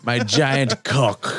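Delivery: slow mock boast. Friend finishing the joke, very slowly drawn out. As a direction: drag it out, very slow.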